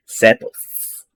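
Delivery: ordinary talk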